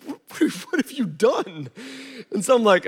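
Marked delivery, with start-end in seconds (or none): 0.1-1.7 much softer, higher, half-laughing disbelief; baffled dad, almost laughing; quiet incredulous question; bewildered disbelief. Retold or imitated. Dad's bewildered reply.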